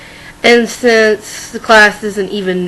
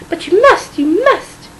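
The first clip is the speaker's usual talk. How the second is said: whiny, pitched-up begging; high insistent pleading; 7 semitones higher, slower than usual. Acted. Wife pleading higher.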